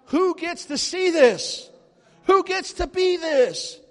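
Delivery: high, loud, drawn-out marvelling cry; awed exclamation. Retold or imitated. Preacher retelling his reaction over the Pentagon, loud slow amazement. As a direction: stretch each word out, loud and amazed.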